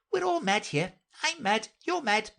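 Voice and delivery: very high, animated cat voice; mad Cheshire Cat. A narrator's reading. The cat tells Alice everyone here is mad, animated.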